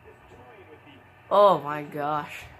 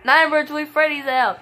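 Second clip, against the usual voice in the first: louder, higher excited call; excited announcement; 9 semitones higher, 11 dB louder than usual. Real, not acted. Commentator announcing Nightmare Toy Freddy's elimination, loud excited call.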